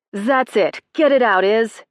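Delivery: loud, high coaching yell. Acted. Coach yelling at the girl punching, loud. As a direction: yell her on to let it out. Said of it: "Amy yelled".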